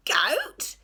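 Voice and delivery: a pirate still disbelieving; shocked again. A narrator's reading. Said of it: "said Hank Chief".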